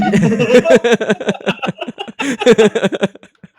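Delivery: big high howling laugh; cracking up at his own punchline; loud laughter. Real, not acted. Punchline of phone store story.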